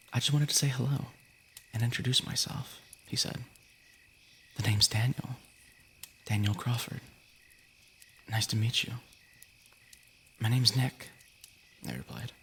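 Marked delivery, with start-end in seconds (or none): none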